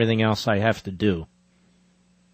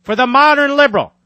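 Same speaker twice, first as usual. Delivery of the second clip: emphatic book reading, higher and louder than his chat; reading aloud; 8 semitones higher, 3 dB louder than usual. Acted. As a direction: read your own book like a speech. Reads own book aloud.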